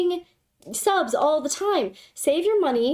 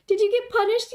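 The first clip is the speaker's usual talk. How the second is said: normal talking voice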